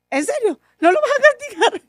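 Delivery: high, laughing disbelief; stunned high-pitched disbelief; incredulous. Retold or imitated. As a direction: gasp it out in disbelief, half laughing. Incredulous retort to husband.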